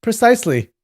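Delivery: high, put-on confirmation; young Taoist impostor. A narrator's reading. Impostor confirms what the elder heard, pitched high. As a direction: pipe up high in eager agreement. Guo Jing confirms in disguise.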